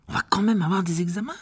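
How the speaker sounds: voix d'élève plus aiguë, inquiète; jeune élève inquiet. Acted. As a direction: protester en garçon affolé. Seamus s'inquiète des examens. (élève inquiet)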